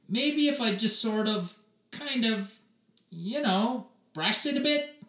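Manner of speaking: put-on high, hesitant little-boy voice; timid boy. Oliver shyly suggests brushing his hair, high and hesitant. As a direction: hedge shyly, searching for words.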